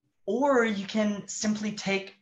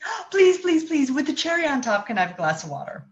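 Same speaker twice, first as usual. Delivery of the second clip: put-on very high, pleading child voice; begging child; 10 semitones higher, 4 dB louder, faster than usual. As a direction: beg over the top for water. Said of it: "Or they might say".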